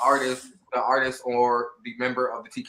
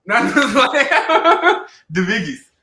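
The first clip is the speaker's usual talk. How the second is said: laughing; 9 semitones higher, 9 dB louder, slower than usual. Real, not acted. Joking shout-out, laughing.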